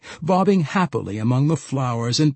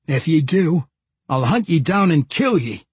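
louder, higher-pitched menacing warning; growled threat; 5 semitones higher, 5 dB louder than usual. A narrator's reading. Josie warning Wulfgar not to hurt her, growling threat. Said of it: "Josie growled".

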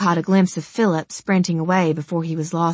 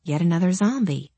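ordinary delivery

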